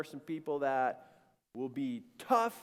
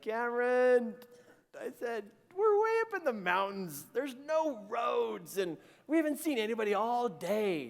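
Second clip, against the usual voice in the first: protesting dad; 7 semitones higher than usual. Retold or imitated. Dad tells son there's nobody in the mountains, exasperated. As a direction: plead with a naive kid in a raised pitch. Dad doubting son's faith.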